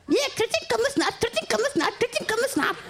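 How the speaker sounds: high, chanting mock voice; high-pitched mimic of a man chanting; Iki's sing-song. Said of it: "så begynder han at lave".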